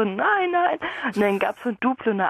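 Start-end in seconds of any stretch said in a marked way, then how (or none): 0.0-0.8 scolding parent. Retold or imitated. Parent voiced in story.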